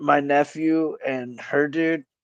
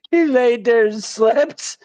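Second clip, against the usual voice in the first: put-on higher, slow, nonchalant little kid; innocent toddler; 8 semitones higher, 3 dB louder, slower than usual. Nephew answering what he saw, innocent and nonchalant. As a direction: imitate an innocent small child, drawn out. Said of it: "he said it so innocently".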